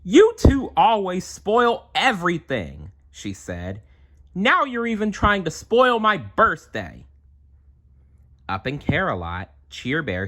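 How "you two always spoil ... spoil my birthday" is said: high, louder upset complaint; upset girl. Acted. Melinda scolds her little siblings, high and upset. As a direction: whine at the twins like a hurt girl.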